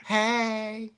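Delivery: high, drawn-out hey; high sing-out greeting, put on; imagined ghost greeting. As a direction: imitate a spirit calling out, high and long. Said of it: "are they gonna be like".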